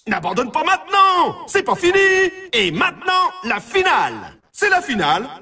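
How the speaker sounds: high-pitched rallying cry; excited announcer building up to the next round; urgent game announcer. Acted. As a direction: spur the player on, voice pitched right up. Announcer urges player on.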